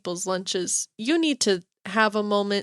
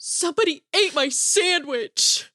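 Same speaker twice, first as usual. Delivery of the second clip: Ross's complaint; 6 semitones higher than usual. Retold or imitated. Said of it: "And he's like".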